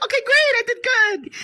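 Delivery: pleased squeal at your own success; amused delight. Retold or imitated. Mother pleased the avatar looks like her, high amused delight. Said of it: "it made me laugh".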